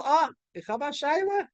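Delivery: high-pitched, rising surprised question; as if the question has just struck; sudden realization. Acted. Teacher acts out the Gemara suddenly raising a question, surprised.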